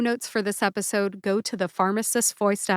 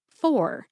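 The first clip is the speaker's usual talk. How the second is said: "for" set apart, higher in pitch; stressed syllable; 5 semitones higher than usual. Shown as a demonstration. Pharmacist showing which syllable to emphasize, "for" raised and stressed. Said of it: "Emphasize the second syllable".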